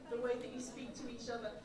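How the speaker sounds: ordinary delivery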